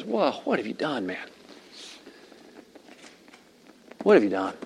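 hushed, tender disappointment; soft, gentle question with no accusation; compassionate, merciful. Shown as a demonstration. God to one who strayed, hushed mercy. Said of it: "In his great compassion and mercy".

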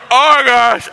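very high, loud husband in distress; mock distressed outcry. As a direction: cry out in hammed-up revulsion. Nauseated husband reacts to fish.

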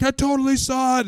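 put-on high-pitched, insistent kid voice; mock excited kid. Boy in the boat backing up the sighting, high mock excitement.

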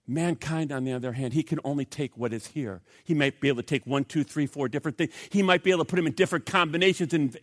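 ordinary talk, nothing marked

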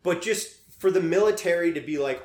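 ordinary talk, nothing marked

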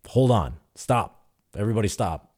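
retold command to halt the room; firm halt. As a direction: cut in firmly and halt everyone. Coach halts the heated session.

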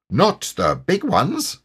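high, slow character reply; high-pitched, drawn-out tiger voice; self-assured tiger. A narrator's reading. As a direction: answer proudly as a story tiger. Tigger answers Piglet.

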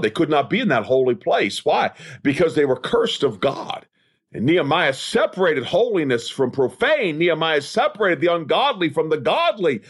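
ordinary talk

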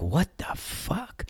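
slow, hushed, rising question; quiet, high, drawn-out astonishment; stunned disbelief. Retold or imitated. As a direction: draw it out softly in rising disbelief. Golfers spot man lying on ground.

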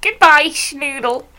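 high, slow mock-sinister farewell; tiny little psychopath. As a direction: draw it out in a high psycho-kid voice. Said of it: "tiny little psychopath".

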